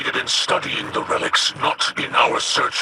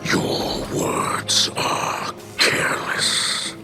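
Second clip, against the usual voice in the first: very slow, low and growling rebuke; stern warning; 12 semitones lower, much slower than usual. Acted. Mithrax warns his scheming old friend, stern and slow. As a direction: drag it out as a low, menacing warning.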